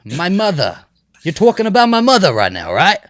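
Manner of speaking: put-on loud, high, combative Jersey accent. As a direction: shout it in an exaggerated Jersey accent. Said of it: "what's a Jersey accent sound like?".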